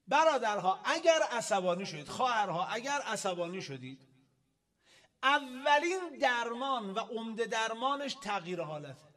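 loud, emphatic sermon delivery; forceful preaching. Real, not acted. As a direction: address the congregation at full force. Preaches at raised volume.

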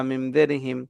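usual speaking voice

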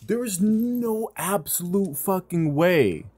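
can't believe what just happened; no way, slowed down in shock; stunned disbelief. Real, not acted. Disbelief at game.

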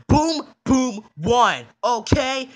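loud, emphatic snap; fed-up shout, every word punched; exasperated outburst. Acted. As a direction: blurt it out to end the nagging. Exasperated answer after nagging.

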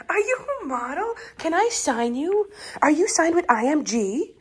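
put-on high, sing-song baby talk to a dog; doting pet talk. Gushing to her dog in a high doting pet voice. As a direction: coo over the puppy like a proud fan. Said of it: "I don't know why I've been doing that accent".

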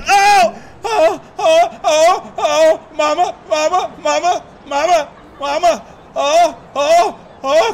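loud high wail for mama; crying for mama. Pretend. Spinning, calling for mama.

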